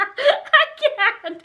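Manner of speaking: laughing too hard to speak; cracking up; high laughter. Real, not acted. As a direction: break up laughing.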